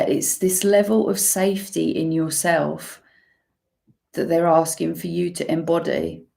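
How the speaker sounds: usual speaking voice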